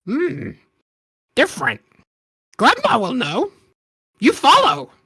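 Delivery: high, halting goblin speech; broken-English little goblin. Acted. Goblin speaks.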